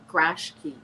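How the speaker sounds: "grashki", garage key squashed to two syllables; "grashki" run together as one word; Chicago slurred compound. Shown as a demonstration. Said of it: "And then we say".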